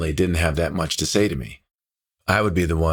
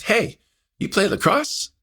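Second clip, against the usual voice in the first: put-on much higher girl's voice, chirpy question; teen girl. Cashier girl greets the boy in the car, high girlish voice. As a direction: voice her as a bright young girl.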